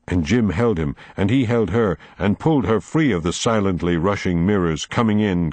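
plain reading voice

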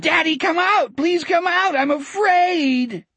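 put-on very high child's wail; high-pitched terrified begging; frightened scream. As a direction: wail for daddy, stretching the last word. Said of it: "Richard screamed".

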